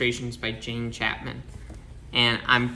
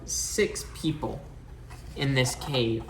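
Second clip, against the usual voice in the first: ordinary delivery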